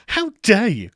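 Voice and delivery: high, loud mock indignation; hammed-up How dare you, high and loud; mock outrage. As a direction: play the scandalised gentleman.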